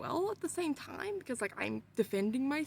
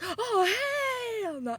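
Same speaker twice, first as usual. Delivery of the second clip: alarmed cry; 10 semitones higher, 6 dB louder, much slower than usual. Retold or imitated. Evil puppy smiles.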